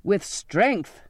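insistent repeated command; captain egging on his mate; urging. A narrator's reading. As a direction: press hard on the word strength. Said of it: "the captain urged".

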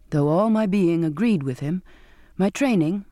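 usual speaking voice

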